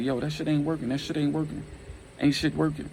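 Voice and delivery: lightly acted griping that it's no use; complaining. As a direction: raise the pitch in a fed-up complaint. Hurting man complains the plan fails.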